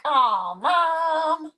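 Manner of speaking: high whiny "Aw, Mom"; whiny kid. Retold or imitated. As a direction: complain like a cub at bath time. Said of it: "they're like".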